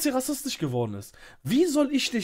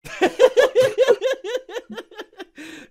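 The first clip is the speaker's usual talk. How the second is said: high gleeful cackle, much louder; triumphant laugh; 13 semitones higher, 7 dB louder than usual. Real, not acted. After kicking Roselia off the call, a loud triumphant laugh. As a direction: crow over it with a big laugh.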